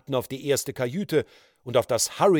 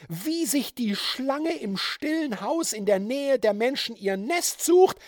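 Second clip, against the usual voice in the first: raving woman; 10 semitones higher, 4 dB louder than usual. A narrator's reading. Georgine rages at the judge.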